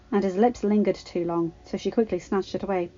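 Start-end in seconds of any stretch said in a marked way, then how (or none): none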